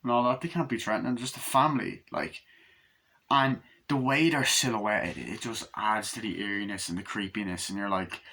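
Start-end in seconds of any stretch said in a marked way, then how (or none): none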